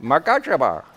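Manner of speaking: makashaba in a light Indian accent. Shown as a demonstration. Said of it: "say it with an Indian accent".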